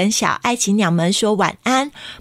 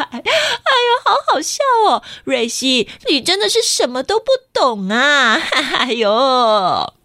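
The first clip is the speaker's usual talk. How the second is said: startled mocking laughter; 5 semitones higher than usual. A narrator's reading. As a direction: cackle at the silly child. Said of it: "吓了一跳地说".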